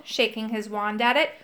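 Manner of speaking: usual speaking voice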